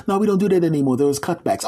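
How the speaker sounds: high, matter-of-fact clerk voice; desk lady. Acted. Gatekeeper lady explaining purgatory cutbacks, breezy.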